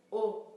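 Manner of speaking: loud model vowel او; model o sound. Shown as a demonstration. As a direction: say او clearly and loudly. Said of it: "صدای او". (تلفظ بلند و واضح او)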